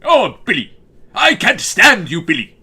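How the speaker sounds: high, loud exasperated cry in a cartoon accent; loud, dragged-out Dracula-style complaint; Grim, Dracula-like. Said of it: "that's really more Dracula".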